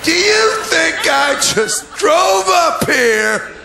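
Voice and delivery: high shouting market trader; hammed-up market seller yell; meat van hawker.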